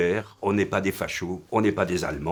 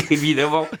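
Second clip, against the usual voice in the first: laughing through the words, voice lifted higher; 7 semitones higher than usual. Real, not acted. Singer laughing at his own youthful mistake, laughing. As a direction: chuckle as you say it, amused at yourself.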